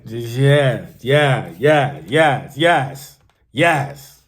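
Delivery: loud, repeated yeses, each punched; emphatic delight. Real, not acted. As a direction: belt out each yes in approval. Savoring the accent she loves.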